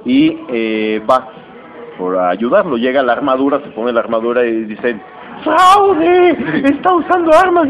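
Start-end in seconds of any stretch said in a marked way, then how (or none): 5.4-7.7 high, loud indignant yell of foul play; outraged cry. Retold or imitated. Iron Man crying foul at the robot opponent, loud outraged yell. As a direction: shout it out like you've caught a cheater.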